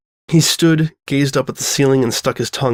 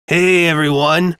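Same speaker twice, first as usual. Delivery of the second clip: calling out; 4 semitones higher, slower than usual. Acted. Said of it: "Flash Sentry cried".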